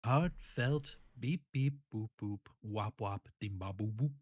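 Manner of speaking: heartfelt robot beeps. Pretend. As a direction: beep like a sad robot. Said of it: "give me your most heartfelt beeps and bloops".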